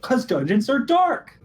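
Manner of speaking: high, drawn-out exclamation; mock-exasperated outburst. Real, not acted. Player to table about dungeons, mock-exasperated outburst. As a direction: pitch jumps way up, stretching the words out.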